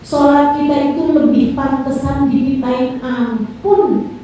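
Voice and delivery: ordinary talk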